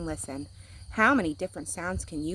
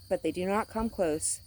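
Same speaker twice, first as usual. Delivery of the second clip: ordinary delivery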